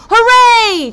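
high, loud triumphant whoop; cheering crowd. Acted. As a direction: cheer like a crowd of eager warriors. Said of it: "cheered all the men".

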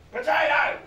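high, loud rising blurt. Retold or imitated. Barry answering about salad, loud blurt. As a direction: shout it like a delighted child. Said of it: "Barry said".

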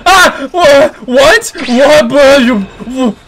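high, loud repeated what; shocked yells. Real, not acted. Gamer shocked by a sudden attack. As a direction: yelp in disbelief.

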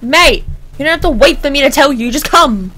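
loud, exasperated yell at the queue; worked up. Acted. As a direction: bellow for the next one, losing patience. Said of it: "Getting a bit worked up".

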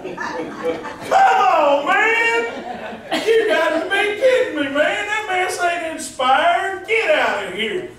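high, loud laughing disbelief; laughing outrage. Real, not acted. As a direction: cry out in amused disbelief. Mock outrage at hymn lyric.

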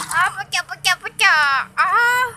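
startled loud cry of dismay; alarmed cry. Real, not acted. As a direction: shout it as something breaks. Something breaks, cry heard.

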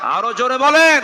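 high, loud shouted demand; rallying shout. Real, not acted. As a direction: belt it out to rouse the whole crowd.

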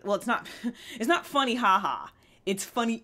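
ordinary talk

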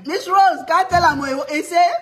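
high, loud appeal to onlookers; loud indignation. Real, not acted. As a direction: call the audience in as witness. Mock scolding over running away.

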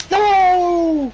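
loud, high, drawn-out cry of no; long wailing shout of protest; real dismayed shout. Real, not acted. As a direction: yell it as the game goes wrong. Player reacts to mishap in game.

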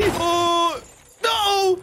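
long, high, loud wail; drawn-out gamer shout of dismay; panicked yell. Real, not acted. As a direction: scream as the car crashes. Crashes the car while blinded.

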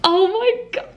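high squeal of delight; thrilled squeal. Real, not acted. Vlogger opening her rare vinyl, squealing.

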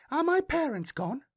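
put-on high, clear child voice; a small girl, light and clear as dawn; light little girl voice. A narrator's reading. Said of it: "her voice was light and clear".

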